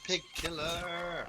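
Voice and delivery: high, long drawn-out mock cry; playful call-out. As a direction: call it out high, stretching the last word. Mock battle cry in the game.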